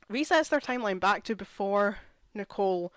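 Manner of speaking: ordinary delivery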